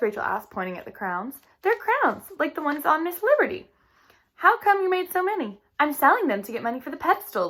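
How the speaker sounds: lively read-aloud storytelling voice; reading to children. A narrator's reading. Teacher reading a storybook to her class, lively. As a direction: read the chapter brightly to young listeners.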